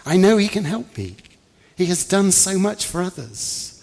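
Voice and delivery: high, loud pleading; loud and insistent. A narrator's reading. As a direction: insist desperately that he can help. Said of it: "She was loud and insistent".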